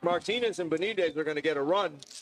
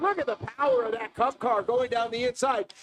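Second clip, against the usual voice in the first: motorsport commentator; 6 semitones higher than usual. Real, not acted. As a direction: narrate every move as it happens. Live race call.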